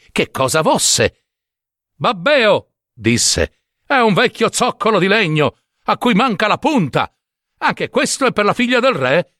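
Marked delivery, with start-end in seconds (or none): 1.9-2.7 higher exasperated jibe; mocking, raised-pitch taunt; scornful name-calling. Brothers scorn him once more. 3.9-9.3 higher, incredulous questioning; scoffing brotherly ridicule; mocking disbelief. Brothers mock the useless find.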